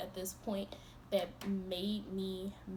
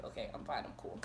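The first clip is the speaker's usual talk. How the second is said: lower, softer, calmed down; mock-composed and soft; forced calm; 11 semitones lower, 8 dB quieter than usual. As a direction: settle the voice, quiet. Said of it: "once I got it out of my system".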